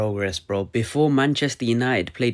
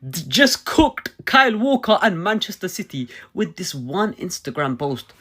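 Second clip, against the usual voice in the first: hyped shout; 8 semitones higher, 3 dB louder than usual. Real, not acted. Hyped football celebration.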